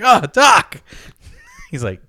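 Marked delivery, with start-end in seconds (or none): 0.0-0.8 high, loud cry of protest; pained yelp. Acted. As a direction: cry out to the doctor like a suffering patient. Patient protests the painful treatment.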